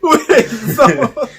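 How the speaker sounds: high, loud words through laughter; laughing. Real, not acted. Retaking the story from partner, laughing loudly. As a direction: laugh while trying to resume.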